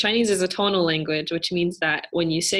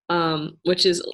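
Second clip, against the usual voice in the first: ordinary talk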